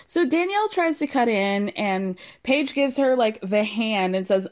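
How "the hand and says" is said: ordinary delivery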